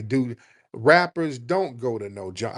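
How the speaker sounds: normal talking voice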